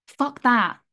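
loud, forceful dismissal; defiant. Real, not acted. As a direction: shut it down hard. Rejecting self-doubt, defiant.

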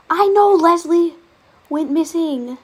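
fed up, shout it at the game; exasperated shout. Real, not acted. Note keeps popping up.

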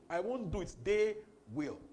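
they will punched loud; contrast I and they. Shown as a demonstration. Preacher contrasting God and believers, they stressed. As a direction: set they against I. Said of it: "preacher contrasting I and they".

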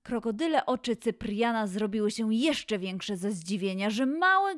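ordinary delivery, nothing marked

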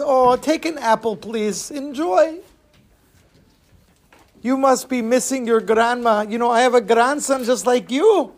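put-on high old-lady voice; kindly old lady. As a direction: coax and comfort the child. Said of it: "there's an old lady sitting next to him".

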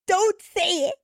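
high-pitched crying plea, hammed up; dramatic, tearful. Daughter pleading with mom on coming home, dramatically tearful. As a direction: imitate yourself wailing at someone. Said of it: "I'm so dramatic. I'm, like, crying".

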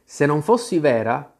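ordinary delivery, nothing marked

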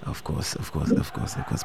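faint breathy murmur over the line; barely audible. Real, not acted. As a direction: trail off to a near whisper. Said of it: "voice is very low".

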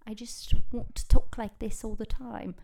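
playful put-on British; British accent. Shown as a demonstration. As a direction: slip into a mock British accent. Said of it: "all of a sudden I'm British".

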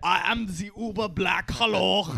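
put-on comic German-accented announcement.

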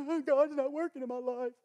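mock whiny complaining; hammed-up whiny complaint; complainer voice. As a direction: whine like a grumbling churchgoer. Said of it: "people, they're so complaining".

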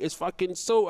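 normal talking voice

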